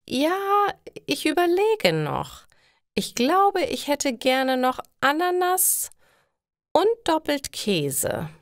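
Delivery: put-on high, sweet elderly customer; old lady voice. A narrator's reading. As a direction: play a dithering old lady.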